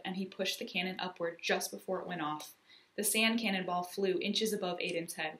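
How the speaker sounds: plain reading voice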